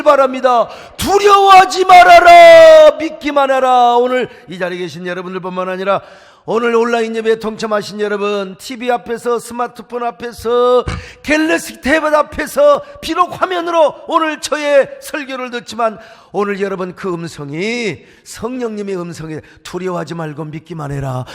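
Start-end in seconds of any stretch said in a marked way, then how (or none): 1.0-4.0 very loud, slow, high shout; preaching shout. Acted. Preacher shouting Jesus's command to the congregation, slow and loud. 19.6-21.2 low gentle voice. Acted. Low quiet take of the quote.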